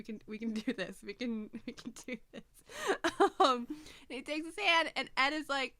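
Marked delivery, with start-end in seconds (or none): 1.0-2.5 real teary pep talk; teary laughter. Real, not acted. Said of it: "I'm crying again". 3.4-4.9 louder, teary giggle on the words; real emotional laugh; laughing through tears. Real, not acted.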